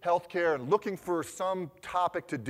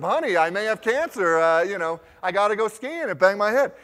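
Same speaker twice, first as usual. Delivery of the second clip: louder, higher hammed-up sob-story pitch; mock plea to wife; 4 semitones higher, 8 dB louder than usual. Husband jokingly pleads with his wife for a ski trip, hammed up. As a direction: sell it to the wife like a tragic excuse. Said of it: "I gotta use that line on my wife".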